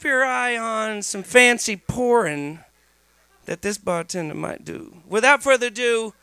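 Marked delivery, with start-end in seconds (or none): none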